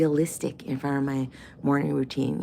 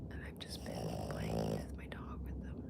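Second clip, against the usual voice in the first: whispering; 12 dB quieter than usual. Real, not acted. Unvoiced whispered aside.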